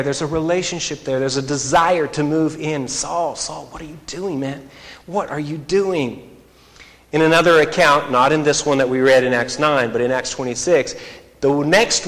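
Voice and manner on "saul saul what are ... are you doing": hushed and pleading; soft pleading. Acted. Jesus gently asking Saul, soft and pleading. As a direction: plead with him gently, almost under the breath. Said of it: "There's a longing there".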